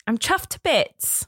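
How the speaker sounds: put-on British, drawn out; corrected British phrase. Shown as a demonstration. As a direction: demonstrate the phrase in careful British. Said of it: "I don't think there's a".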